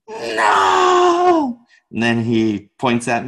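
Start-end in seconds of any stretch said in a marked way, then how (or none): 0.1-1.6 very high, long mock shout; hammed-up hotheaded teacher rejecting an answer; imitated yelling. Teacher rejects dancer's answer, imitated long loud yell. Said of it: "He just kept yelling".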